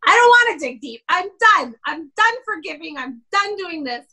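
high, loud defiant whine; petulant attitude. Retold or imitated. Wife refusing husband's advice, high defiant whine. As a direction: refuse it like a fed-up child. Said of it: "I had an attitude".

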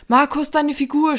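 high, loud alarmed shout. Acted. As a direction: yell the warning. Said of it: "schrie Tommy".

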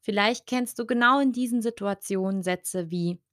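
ordinary talk, nothing marked

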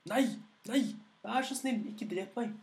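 a frightened dragon begging for its life. Acted. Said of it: "ropte dragen".

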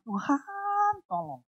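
pleading cry that fades to a murmur; plea starting high, dying away; desperate prayer. Retold or imitated. Saya berdoa terus sama Tuhan.

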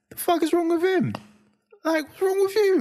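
put-on very high, baffled kid voice; mock incredulity. Dad voicing the older brother staring at the crier, mock incredulous. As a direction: squeak it out in playful disbelief.

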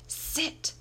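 short, high command; ordering. A narrator's reading. Master ordering his dog, sharp command. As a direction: give the dog a one-word order. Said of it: "he ordered".

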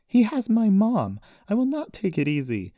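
high, loud, emphatic yelling; angry yell. A narrator's reading. Daughter yelling at friend who pulls her away, angry. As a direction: yell back, refusing to calm down. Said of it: "she yelled at him".

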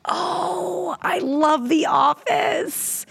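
high, slow excited cry; excited squeal. Real, not acted. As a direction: squeal with delight. Fan of favorite show.

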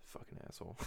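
half-whispered jokey insult; soft breathy jab at a brother; muttered. Real, not acted. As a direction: mutter it under your breath, teasing.

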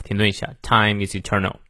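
ordinary delivery

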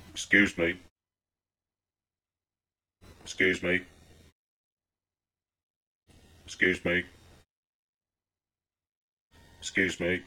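soft, low mumbled excuse me over and over; timid repeated excuse me. Retold or imitated. Man at a car window quietly calling to an oblivious old woman. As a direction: mutter it meekly, again and again. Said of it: "John was undeterred and continued on".

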